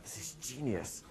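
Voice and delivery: soft, high, drawn-out amazement; retold hushed astonishment; awed reaction. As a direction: marvel quietly, stretching the words. Retelling his amazed reaction.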